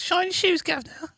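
very high, squeaky Cockney put-on; hammed-up London street shoeshine voice; mock London accent. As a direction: play a high-pitched cartoon Londoner. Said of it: "Where's that from? ... That's London".

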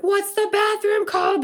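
high, frantic squeak of panic; rushing to find a bathroom, a panicked high squeak. Retold or imitated. Asking her husband for the bathroom in a high panicked squeak. Desperate bathroom emergency, acted self.

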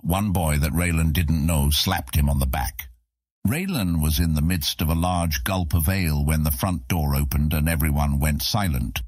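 plain reading voice

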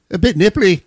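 lightly put-on Irish lilt, pitched high; Irish accent. Host joining a bit about cold water, mock Irish. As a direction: try a playful Irish accent. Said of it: "I don't know why I went Irish".

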